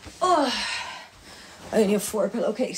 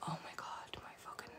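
whisper; 4 semitones lower, 23 dB quieter than usual. Real, not acted. Reacting to sore eye.

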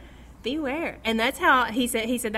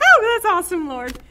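much higher, louder, delighted gasp of praise; excited exclamation; 9 semitones higher, 13 dB louder than usual. Retold or imitated. Preacher retelling finding the verse, thrilled outburst. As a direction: burst out with joy at the find.

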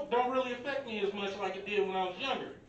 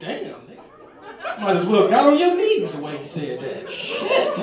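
laughing; 9 dB louder, slower than usual. Retold or imitated. Laughing reaction to the insult.

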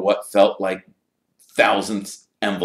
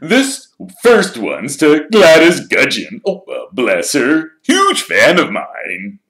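slow, high and loud showman's voice; vain Lockhart; 8 semitones higher, 11 dB louder, slower than usual. Acted. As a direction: preen like a celebrity signing photos.

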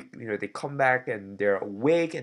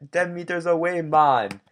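bad Jamaican impression; 6 semitones higher, 8 dB louder than usual. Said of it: "a very bad impression of a Jamaican man".

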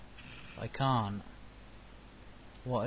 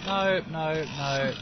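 scared horror game streamer; 3 semitones higher, slower than usual. Real, not acted. Sudden threat in game.

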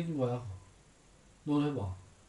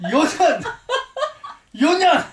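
much higher, loud, scolding bark through a laugh; mother-in-law yell; 13 semitones higher, 9 dB louder, much slower than usual. Retold or imitated. Husband imitating his mother scolding the daughter-in-law, loud laughing yell. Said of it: "시누이들이 요년 하면서".

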